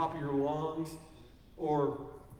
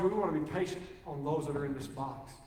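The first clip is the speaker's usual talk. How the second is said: usual speaking voice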